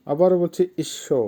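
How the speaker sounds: normal talking voice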